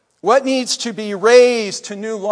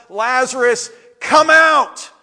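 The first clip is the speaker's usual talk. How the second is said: commanding shout; 4 semitones higher, 5 dB louder than usual. Retold or imitated. Jesus calling Lazarus out of his tomb, shouting. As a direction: call out loudly, pitch rising. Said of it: "Jesus stands outside of Lazarus' tomb and shouts".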